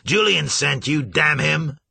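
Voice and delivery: loud angry shout; furious shout. A narrator's reading. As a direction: bellow the accusation. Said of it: "he roared down at the darkness".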